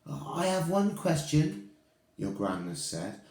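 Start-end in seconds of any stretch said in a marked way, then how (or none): 0.1-1.7 high witch voice. Acted. Witch from audience asks.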